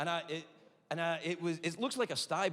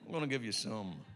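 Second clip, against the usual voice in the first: deep doctor voice; 7 semitones lower than usual. Retold or imitated. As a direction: drone it like a bored doctor.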